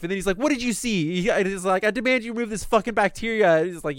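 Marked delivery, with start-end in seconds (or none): 0.4-1.2 higher-pitched mock outrage; retold yelling. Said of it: "he starts yelling at Mayuri". 1.8-3.6 high-pitched indignant mock demand; retold yelling. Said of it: "he starts yelling at Mayuri".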